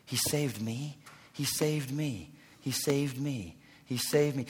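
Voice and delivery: soft awed murmur; amazed, hushed wonder. Retold or imitated. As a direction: whisper it in grateful amazement. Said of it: "continually amazed".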